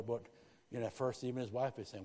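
ordinary delivery

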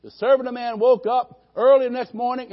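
ordinary talk

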